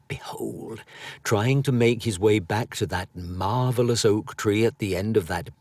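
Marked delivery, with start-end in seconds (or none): 0.1-0.8 breathy hushed whisper. A narrator's reading. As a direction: whisper it like a secret. Narrator hushes the reveal.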